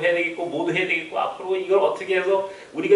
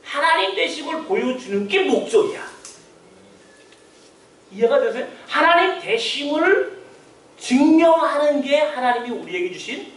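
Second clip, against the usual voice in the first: raised, pressing sermon voice; fervent preaching; 7 semitones higher than usual. Acted. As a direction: build the climax from the pulpit.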